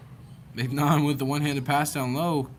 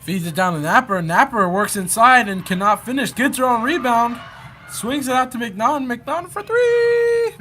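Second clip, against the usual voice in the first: excited play-by-play call; 11 semitones higher, 8 dB louder than usual. Real, not acted. Commentator calling a three-point try to listeners, rising excited call. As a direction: build the call as the shot goes up, stretching three. Live call building to shot.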